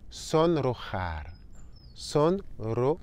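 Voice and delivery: sonrojar spelled out slowly with rolled r and throaty j; slow model word. Shown as a demonstration. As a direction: drag out sonrojar sound by sound. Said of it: "le re et le re dans le même mot".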